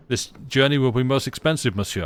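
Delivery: a light put-on French accent. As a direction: lightly play the French valet. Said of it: "I'm not gonna do the accent for this".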